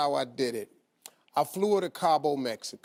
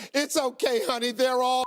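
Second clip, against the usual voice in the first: higher, sympathetic woman's voice; comforting stranger; 9 semitones higher than usual. Retold or imitated. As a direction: play a kind lady soothing a crying stranger. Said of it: "the woman looks at her and goes".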